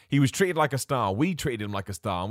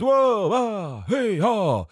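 louder, higher hammed-up shocked commentator gasps; mock shocked commentator; 8 semitones higher, 4 dB louder, much slower than usual. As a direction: send up a commentator overreacting to everything. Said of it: "they're so shocked by everything".

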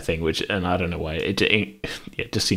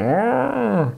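mock sob.

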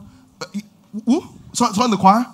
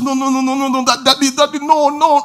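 put-on high pleading child's voice; a light child's plea, higher; crying child; 9 semitones higher than usual. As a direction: beg Daddy not to leave, high. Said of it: "he begins to cry".

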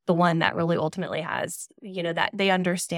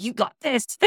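higher-pitched, lightly imitated cheerleader hype; Rocky Balboa pep talk; 6 semitones higher than usual. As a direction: give a quick imitated pep talk before a big moment. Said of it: "talked to me like Rocky Balboa".

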